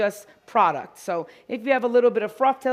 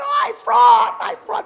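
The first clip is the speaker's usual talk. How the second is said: high excited shout, then tails off; excited fan lady shouting; 12 semitones higher, 9 dB louder, slower than usual. Retold or imitated. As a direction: call it out from across the lot like a thrilled fan. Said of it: "She's like".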